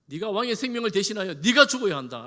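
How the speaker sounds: loud, forceful scolding; thundering rebuke. Acted. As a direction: roar the accusation at a negligent guard. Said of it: "다윗이 호통을 칩니다".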